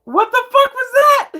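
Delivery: very high, loud disbelieving shriek; real squealing disbelief; stunned outburst. Real, not acted. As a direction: shriek the question at the screen. Baffled outburst at awkward scene.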